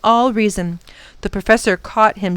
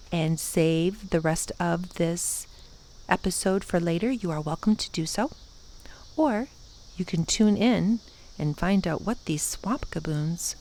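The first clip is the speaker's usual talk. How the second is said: soft aside; 8 dB quieter than usual. Real, not acted. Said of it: "narrator's aside to listeners".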